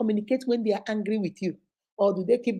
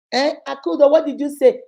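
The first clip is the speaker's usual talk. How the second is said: nosy friend; 5 semitones higher, 6 dB louder than usual. Retold or imitated. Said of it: "she's always murmuring".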